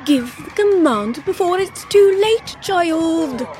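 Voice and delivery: high, loud, pressing plea; urgent queen. A narrator's reading. Queen pressing her daughter to give the command, urgent. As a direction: urge her at high pitch and volume.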